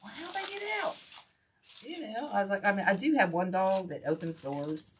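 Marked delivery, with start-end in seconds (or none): 0.0-1.0 high, incredulous question; retold surprised question. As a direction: ask it high-pitched, disbelieving. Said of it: "And I'm like".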